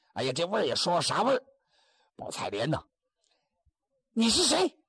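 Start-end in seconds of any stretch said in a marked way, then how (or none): 4.1-4.8 put-on high, sharp startled girl's cry; 姑娘惊问. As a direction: snap it out as the startled young woman. 女子在网中惊问. (尖声惊问的姑娘)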